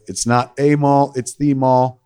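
demonstrated line reading, "a" as "ay", "the" as "thee"; stress on A and THE. Retold or imitated. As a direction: punch "a", then set "the" against it. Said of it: "I'd line read".

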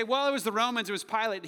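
high, forceful acted finger-pointing; loud mock excuse. Preacher mimics blaming the Romans, loud mock excuse. Imagined blame game, acted loudly.

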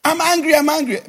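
high-pitched mock angry outburst; acted anger. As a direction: announce your anger to everyone, pitched high. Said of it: "partaker of my anger".

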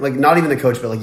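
ordinary talk, nothing marked

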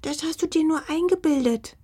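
high, sing-out tiny creature voice; small high-pitched fox voice; fine little voice. A narrator's reading. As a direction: deny it in a dainty high voice. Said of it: "antwortete ein feines Stimmchen".

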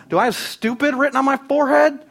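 sarcastic parent not fooled; exasperated parent. Retold or imitated. Parent to kids caught with brownies, incredulous sarcasm.

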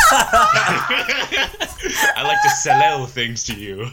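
laughing demo, an extra L on sell; double L doubled. Shown as a demonstration. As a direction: sell said in two beats as sell-el, high and slow. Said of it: "pronounces every double L as two L's".

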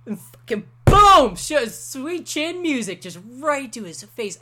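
loud, high, slow burst on the boom; excited climax. Real, not acted. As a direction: hit the big moment like a ringside fan. Climax of retold match.

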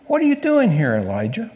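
sharp high call, then dropping on the name; voice of God asking. Acted.

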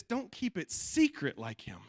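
ordinary talk

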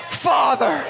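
usual speaking voice

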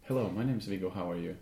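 low, soft seductive voice; mock sexy voice. Shown as a demonstration. A host imitates the sultry ad voice, low and soft. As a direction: lightly put on a low sultry voice. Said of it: "with that voice?".

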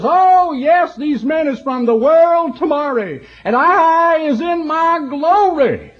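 put-on high, loud, slow old lady voice; high, joyful voice of an elderly Louisiana woman; elderly Southern lady. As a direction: beam with joy like an old country woman. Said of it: "She says".

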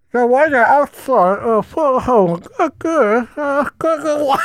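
slow, high-pitched, badly faked British accent; attempted British accent. Shown as a demonstration. Premiere of host trying British on friend's advice, badly faked British accent. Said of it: "trying to speak in a British accent".

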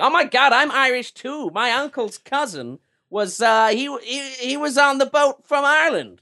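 high, loud gushing claim of Irish roots; squealing Irish wannabe; gushing Irish-American. Retold or imitated. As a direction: play the American bragging they're Irish too. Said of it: "It's like".

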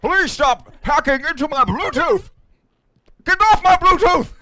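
high, loud, slow distorted voice; glitchy robot voice. Acted. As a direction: play a hacked speaker.

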